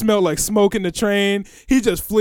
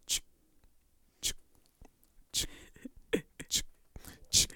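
imitating a lighter clicking with a soft ch; soft repeated ch sound like a flicking lighter; 18 dB quieter than usual. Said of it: "all I heard was ch".

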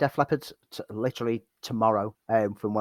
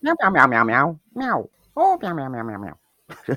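high cat meows; cat meowing; 4 semitones higher than usual. Pretend. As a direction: meow like a cat. Meowing back in the bit.

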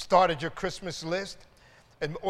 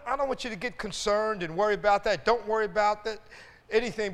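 ordinary talk, nothing marked

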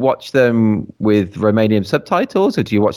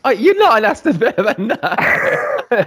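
laughing; 11 semitones higher, slower than usual. Real, not acted. Rival club reveal, mock outrage.

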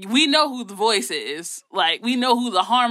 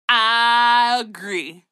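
long drawn-out I, louder; emphatic agreement; 3 semitones higher, 5 dB louder, much slower than usual. Real, not acted. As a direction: stretch I right out in hearty agreement.

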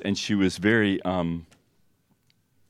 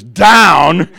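loud, higher rising "Down?"; loud startled reply; 7 semitones higher, 18 dB louder than usual. Retold or imitated. The patient answering in alarm, loud and rising. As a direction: blurt it out in alarm.

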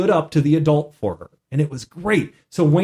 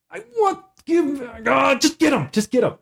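high, sputtering mock frustration; stammer, then give up and order them off; imitating an exasperated plea, pitched way up; exasperated king; 11 semitones higher than usual. Oz loses patience with the players.

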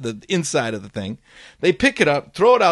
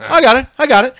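high, loud kid pilot impression; mock youthful high holler; young pilot; 11 semitones higher, 8 dB louder, faster than usual. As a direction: insist eagerly like a cocky youngster. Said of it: "this twenty-two-year-old kid flying the plane".

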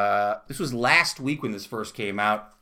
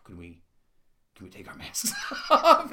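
hopeful gym crowd; 3 semitones lower than usual. Retold or imitated. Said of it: "just sort of like".

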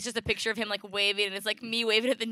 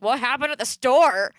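louder mock-alarmed question; retold demanding question; 4 semitones higher, 8 dB louder than usual. Partner asking as they walk in, loudly demanding. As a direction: imitate a partner demanding an explanation.

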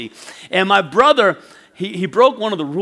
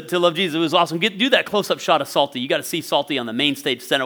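usual speaking voice

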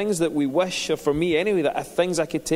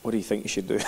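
low, soft rebuking inner voice; a quiet, lower voice calling him out; the Holy Spirit; 7 semitones lower, 5 dB quieter, faster than usual. Retold or imitated. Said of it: "And it was the Holy Spirit".

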